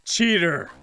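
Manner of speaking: loud accusing yell. Acted. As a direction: shout cheater at him. Ghost yells accusation.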